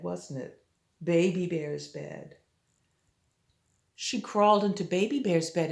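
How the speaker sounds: plain reading voice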